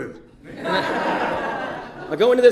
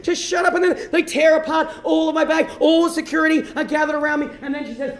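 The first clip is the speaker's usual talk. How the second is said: ordinary talk, nothing marked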